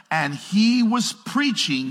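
slow, loud preached scripture; preaching reading. Acted. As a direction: lift it up from the pulpit.

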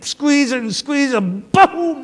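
squeeze, squeeze, then a high excited boom; mounting excitement up to a sudden pow; building to boom. Retold or imitated. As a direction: build the pressure and let it burst. Kids popping the cork bottle.

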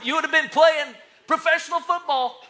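high, loud mock-wistful boast. Preacher teasing a man stuck on past glory, mock boasting. Mimicking Uncle Rico's regret, mocking.

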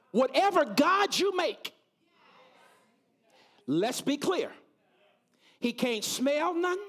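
fiery preacher, building cries; preaching at full force. Acted. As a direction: preach it out hard, rising. Preaching climax, shouts.

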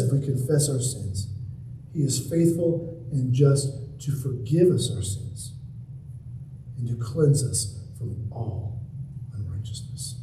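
low, softened verse with long pauses; hushed scripture. Real, not acted. Preacher repeating the verse as assurance of forgiveness, hushed and low. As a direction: let it sink down quietly, ending very soft.

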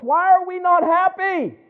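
high, loud, slow and pleading; anguished cry. Real, not acted. Preacher asking the congregation, slow, high and anguished. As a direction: cry it out to the rafters. Anguished question peaks the list.

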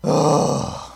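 loud heavy huff of reluctance; exasperated sigh. Retold or imitated. Husband asked to leave his warm bed, exasperated sigh. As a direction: huff out a put-upon sigh. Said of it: "I might have gone like this".

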